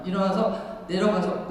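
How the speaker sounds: normal talking voice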